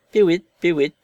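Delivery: high, loud double bird call; mimicked lapwing cry. A narrator's reading. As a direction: mimic the bird's call back. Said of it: "fit monsieur Marvel". (cri de vanneau imité, aigu)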